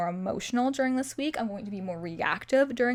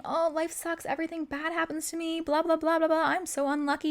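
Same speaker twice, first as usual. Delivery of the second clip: higher-pitched, monotonous pity-party rattle; mock complaining; 6 semitones higher than usual. Imitating someone who keeps saying life sucks, complaining. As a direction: parody someone moaning about their bad luck. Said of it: "if you keep going and saying".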